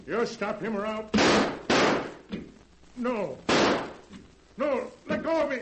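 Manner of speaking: frantic pleas building to a high scream; panicked struggle. Acted. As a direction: fight off the machine in terror. The sheriff is seized by the robot.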